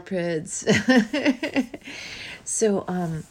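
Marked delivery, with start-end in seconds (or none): 0.7-2.4 a loud burst of real laughter; bright laugh. Real, not acted. Podcaster laughing into the mic at the start. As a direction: laugh out loud before you speak.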